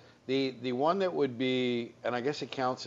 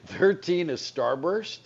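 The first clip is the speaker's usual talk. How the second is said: laughing; 6 semitones higher, 4 dB louder, slower than usual. Real, not acted. Laughs reading the ranking.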